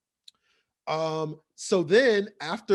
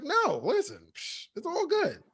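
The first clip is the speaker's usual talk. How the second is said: casual brush-off; 10 semitones higher than usual. Retold or imitated. Pietro brushing it off.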